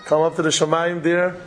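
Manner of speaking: ordinary talk, nothing marked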